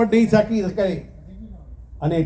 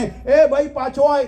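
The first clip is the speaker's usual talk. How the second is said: raised, higher call to the boatman across the water; calling across the river; 6 semitones higher, slower than usual. Retold or imitated. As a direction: shout him back from the far bank. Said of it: "પેલા બૂમ પાડી યુવાને". (નદી પાર નાવિકને ઊંચા અવાજે બૂમ)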